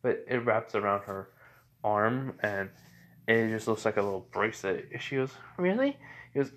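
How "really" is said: put-on very high child's question; Eri. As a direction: ask it pitched right up like a little girl. Little girl asks hopefully.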